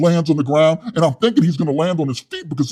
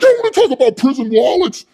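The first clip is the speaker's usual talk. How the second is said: high, loud, puffed-up yelling; loud hothead Wes; 10 semitones higher, 6 dB louder than usual. Retold or imitated. Wes scolding his soy boy cellmate, loud and high. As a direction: rant like a tough guy with something to prove.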